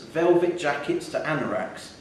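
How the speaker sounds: ordinary delivery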